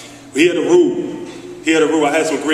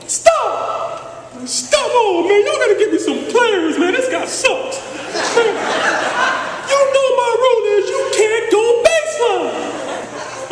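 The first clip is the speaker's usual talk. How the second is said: Coach Chaney's voice; slower than usual. Retold or imitated. Coach scolds his player for not going baseline, squeaky shouting. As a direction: yell like an exasperated old coach, voice cracking high. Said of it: "he speaks like this".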